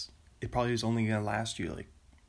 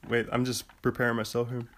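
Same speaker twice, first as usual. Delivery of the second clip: ordinary talk, nothing marked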